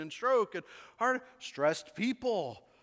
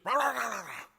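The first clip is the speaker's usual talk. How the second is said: higher, louder mock rant noise; mock angry ranting; 4 semitones higher, 3 dB louder, faster than usual. Pastor mimicking a stressed spouse ranting, mock rah rah. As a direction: imitate a stressed snapping rant. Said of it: "you just".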